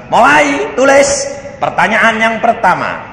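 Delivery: tirukan guru memberi perintah, nada tinggi; aba-aba kelas yang ditekankan. Retold or imitated. (suara guru memulai ulangan)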